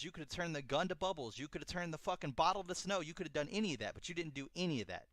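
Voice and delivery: high, heated imitation of an accuser; retold accusing rant. Retold accusing rant at Doctor Manhattan.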